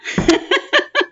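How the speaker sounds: a sudden high burst of giggling; bright high laugh; high giggle. Real, not acted. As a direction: giggle up high, real amusement. Laughs after greeting Swan.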